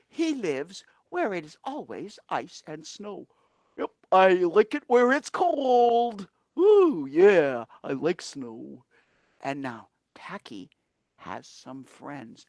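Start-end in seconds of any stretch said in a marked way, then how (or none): 3.8-8.8 Tacky; perky penguin voice, louder; penguin puppet voice. Acted. Cheerful bird puppet glee. 9.4-12.4 hushed storyteller whisper. A narrator's reading. Narrator hushing the children for the friends.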